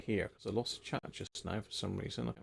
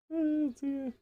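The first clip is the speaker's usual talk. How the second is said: jumps much higher on oh dear; mock dismay. Real, not acted. After his bad-accent joke, high mock dismay. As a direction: squeak out an amused oh dear.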